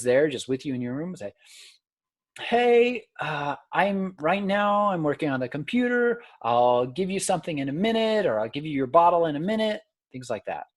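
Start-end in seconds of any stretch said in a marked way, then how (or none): 2.4-9.8 put-on higher, lively parent chatter to a baby; narrating to baby. Shown as a demonstration. Parent at the computer narrating to the baby, higher pitched. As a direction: tell the baby what you're doing. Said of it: "narrate your daily activities".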